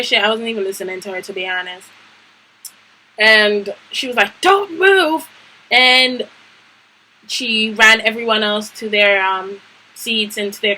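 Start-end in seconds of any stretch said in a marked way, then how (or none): 4.4-5.3 high-pitched mock order; imitated yelling. Vice principal stopping the fighters, high imitated yell. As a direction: mimic a vice principal's sharp command. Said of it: "She yelled at us".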